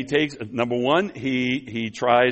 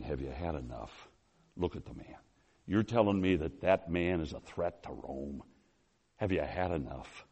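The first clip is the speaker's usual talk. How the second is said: low, very soft pleading as Pilate; hushed appeal; 6 semitones lower, 12 dB quieter than usual. Acted. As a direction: appeal to the crowd, barely above a murmur. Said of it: "Pilate is so frustrated".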